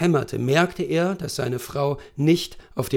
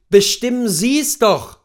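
cold; 8 semitones higher, 8 dB louder than usual. A narrator's reading. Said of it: "sagte Macomber kalt".

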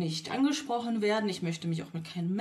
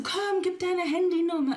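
much higher, louder insistent pushy guy; imitating the man; 10 semitones higher, 4 dB louder than usual. Imitating the stranger insisting on her number, pushy. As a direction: mimic him demanding the number. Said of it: "Und er so".

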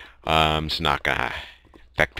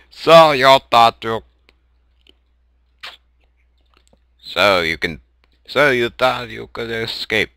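lightly voiced villain over a speaker; a hint of a taunting villain; intercom villain voice; 4 semitones higher, 4 dB louder than usual. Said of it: "That's my intercom voice".

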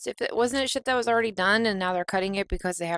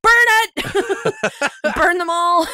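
high, loud laughing yell; yelling burn it; 12 semitones higher, 6 dB louder, much slower than usual. Retold or imitated. Yelling at the horror movie kids on screen, laughing. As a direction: shout it gleefully at the screen. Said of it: "And I'm like".

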